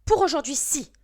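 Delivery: teacher snapping back at the protesting pupil; curt reply. Acted. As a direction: cut the protest short, no discussion. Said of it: "a répondu sèchement madame Balin".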